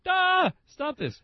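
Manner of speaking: high, slow put-on grumble of an old-school owner; mock old-timer. Owner waved off new computers, high slow mock grumble. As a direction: drag it out like a fussy old restaurateur.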